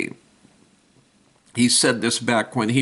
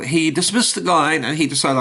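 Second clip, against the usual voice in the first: usual speaking voice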